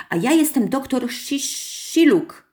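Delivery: put-on high, small-boy exclamation; high child voice tripping over a hard word; little boy calling out. A narrator's reading. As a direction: proudly call out like a little child. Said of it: "zawołał mały Grześ".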